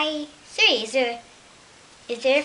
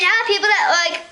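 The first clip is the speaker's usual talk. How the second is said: usual speaking voice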